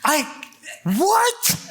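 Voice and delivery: high, broken, laughing shock; stunned. Retold or imitated. Disciples recognizing Jesus at the bread, stunned. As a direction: stammer in disbelief. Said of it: "they realize".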